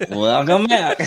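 high mock announcer with a laugh; put-on host welcome, breaking into laughter; hammed-up broadcast greeting; broadcaster voice. Shown as a demonstration. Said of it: "That's a broadcaster voice".

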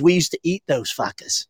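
lightly imitated Kiwi accent, r dropped; imitated New Zealand accent. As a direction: quote him in a mild New Zealand accent. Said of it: "I'm from New Zealand".

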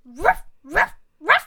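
loud barking dog; a dog in the story, loud barks. Shown as a demonstration. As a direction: bark like a dog.

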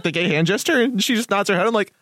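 normal talking voice

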